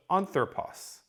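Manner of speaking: "anthropos" stressed on its first syllable, AN. Shown as a demonstration. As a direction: hit the opening syllable of "anthropos". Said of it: "puts the emphasis on this initial syllable".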